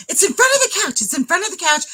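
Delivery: high, exasperated repetition; urgent mock panic; retold frantic insistence. Retold frantic directions to husband.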